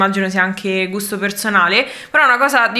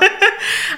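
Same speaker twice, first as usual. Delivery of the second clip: high laugh; 11 semitones higher than usual. Real, not acted. Keeps laughing.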